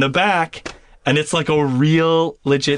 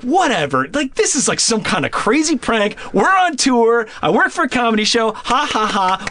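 high-pitched, incredulous rant; retold disbelief; 7 semitones higher than usual. Storyteller insists to staff it's a prank, incredulous. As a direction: protest the prank in a raised pitch.

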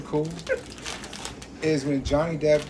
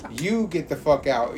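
normal talking voice

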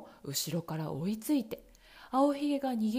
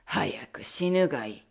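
much lower, cold menacing voice; cruel villain; 11 semitones lower, 5 dB louder than usual. Acted. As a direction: pronounce it like a death sentence. Bluebeard threatening.

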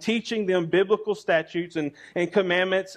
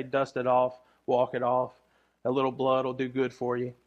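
gruff dad; 7 semitones lower, 6 dB quieter than usual. Retold or imitated. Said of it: "we tend to say".

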